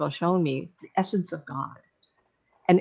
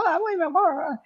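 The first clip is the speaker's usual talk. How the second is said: high, sudden startled cry; 10 semitones higher, faster than usual. Retold or imitated. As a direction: catch yourself, suddenly alarmed. He suddenly notices the cars.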